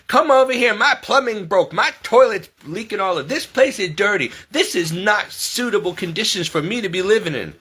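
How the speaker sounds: lightly hammed-up complaining tenant; mocking complaining tenant. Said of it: "they have the nerve to come".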